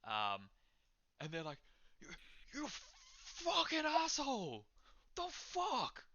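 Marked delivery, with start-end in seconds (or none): 2.0-6.0 high, sputtering outrage; stammer, then burst out in high-pitched fury; freaked-out patron turning furious. Acted. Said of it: "now just getting furious at you".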